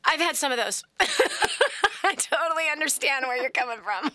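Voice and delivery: amused, laughing through the words. Real, not acted. Host admitting to caller she had tantrums too, laughing. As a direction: laugh along in sympathy as you speak.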